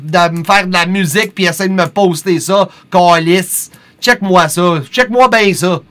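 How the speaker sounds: loud, high Quebecois swearing; Québécois en colère qui sacre fort; Quebec French rant. Pretend. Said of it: "That's some real Quebec French for y'all".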